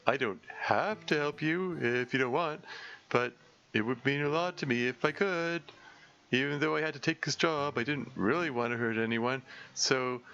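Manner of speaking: earnest gentle monster. Acted. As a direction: make your case like a kindly hired brute. Terrence explains his contract.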